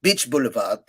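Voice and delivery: put-on French-accented English with softened r. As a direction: imitate a Frenchwoman struggling through English. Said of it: "I have a very good French accent".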